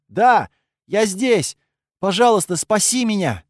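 high, loud desperate plea; pleading cry for help. A narrator's reading. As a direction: call out begging to be saved. Trapped girl calls for help.

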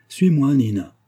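calling out. A narrator's reading. Mother rushing to the door, calling out to daughter. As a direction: call her along, urgently. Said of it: "cria madame Karsov".

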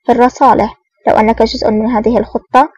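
usual speaking voice

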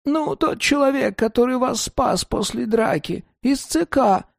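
put-on high old woman, worked up; agitated grandma. A narrator's reading. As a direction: tell the news anxiously like grandma. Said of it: "взволнованно сказала бабушка".

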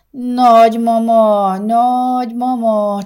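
put-on drawn-out, high, pleading child's voice; whining little boy. A narrator's reading. Small boy nagging his grandmother, slow high whine. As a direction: stretch each word like a nagging child.

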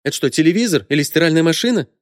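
high, indignant sarcastic question; irritated retort. A narrator's reading. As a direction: snap it back at a pushy helper. Customer snapping at pushy saleswoman.

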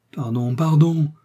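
slow, high, loud pleading pardon; begging cry. A narrator's reading. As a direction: beg forgiveness on his knees. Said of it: "s'écria Felton".

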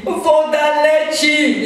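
high, loud, drawn-out wail of alarm; panicked mock cry. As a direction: call out the leak in exaggerated alarm. Acts out panic at leaking water.

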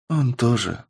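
sighing, a touch of sadness; sad sigh. A narrator's reading. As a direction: sigh it out softly and sadly. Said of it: "печально вздохнула ведьма". (печальный вздох)

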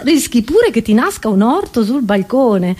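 usual speaking voice

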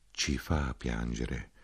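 low, slow and soft, heavy with grief; sorrowful soldier. Acted. A soldier telling the narrator they weep, low and slow. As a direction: let each word fall, mournful.

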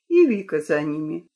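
ordinary delivery, nothing marked

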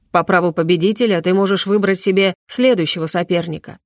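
ordinary delivery, nothing marked

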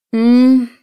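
loud, high yawn through the lips; yawning hum. Acted. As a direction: stretch out a sleepy yawning mm. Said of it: "зевнула Наталья". (зевающее мычание)

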